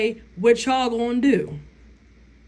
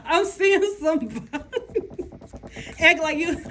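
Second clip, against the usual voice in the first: high laughter breaking through words; can't stop laughing at her own joke; laughing fit; 6 semitones higher than usual. Real, not acted. To her viewers, cracking up at a vision, high laughing. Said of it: "I'm sorry, guys. I get these visions".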